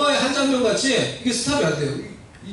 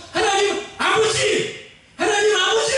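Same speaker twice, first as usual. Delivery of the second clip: crying out; 11 semitones higher than usual. Acted. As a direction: call out to God with all your heart. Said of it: "부르짖으며 외치며". (하나님께 높게 부르짖기)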